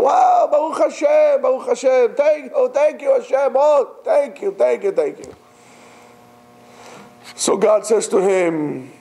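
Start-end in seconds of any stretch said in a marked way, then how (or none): 0.0-5.3 ecstatic thanks. Retold or imitated. Climber thanks Hashem, high and gushing. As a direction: pour out gratitude. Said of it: "He goes".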